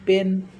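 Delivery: "पेन" with a short cut-off "ए"; short clipped e. Shown as a demonstration. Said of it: "ए छोटा cut करना है". ("पेन" में "ए" छोटा काटकर बोलें)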